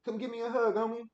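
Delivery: high, loud playful dad voice. Real, not acted. Dad asks his son for a hug.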